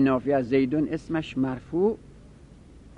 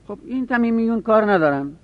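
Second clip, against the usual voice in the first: ordinary talk, nothing marked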